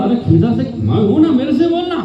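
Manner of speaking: high, playful divine voice; a lightly playful voice from above; voice of God. Acted. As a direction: lift the voice up high, teasing and gentle. Allah's voice in the story, high.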